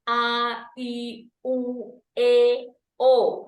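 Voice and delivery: A, I, U, E, O each held long and distinct; pure, separated vowels A, I, U, E, O; articulated vowels. Shown as a demonstration. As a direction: sound out A, I, U, E, O one by one, firmly. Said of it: "mahrojnya harus benar".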